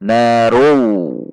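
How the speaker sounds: "Narow" ending in a W glide instead of L; drawn-out "Narow" with no L at the end; wrong pronunciation demo. Shown as a demonstration. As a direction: show the mistake, drawing "Narow" out into a w. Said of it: "kesalahan yang sering terjadi".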